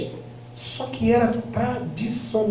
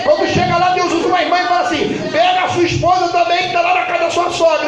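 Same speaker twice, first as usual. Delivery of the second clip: high, driving revival preacher delivery; full-force preaching; 8 semitones higher, 4 dB louder than usual. Real, not acted. Preacher testifying to the church about the pastor, full-force preaching.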